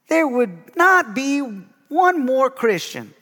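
high, slow Southern preacher drawl; J. Vernon McGee southern drawl. Retold or imitated. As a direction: stretch the vowels like an old Southern radio preacher. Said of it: "I was gonna do an impersonation".

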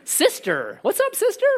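very high put-on hello, sister; mock sister greeting. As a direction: slide up into a goofy sister greeting. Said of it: "Gal, chick, yeah".

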